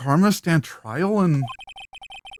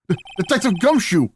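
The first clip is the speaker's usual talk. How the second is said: alarmed; 7 semitones higher, 4 dB louder than usual. Acted. Lawyer alarmed at the pistol.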